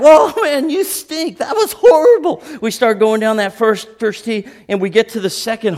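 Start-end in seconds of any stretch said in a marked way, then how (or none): 0.0-2.4 high, loud mocking jeer; teasing buddy laughing at a bad drive; mocking golf buddy. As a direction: sneer at a bad tee shot. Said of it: "He's like".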